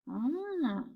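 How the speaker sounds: ordinary talk, nothing marked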